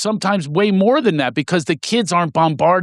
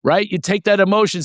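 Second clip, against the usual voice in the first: ordinary talk, nothing marked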